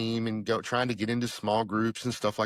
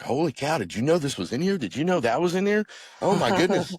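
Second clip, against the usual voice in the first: amazed excitement; 6 semitones higher than usual. Retold or imitated. Retelling to his wife how amazed they were at the Bible, higher and excited. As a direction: gasp it out like you just found treasure.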